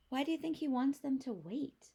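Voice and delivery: usual speaking voice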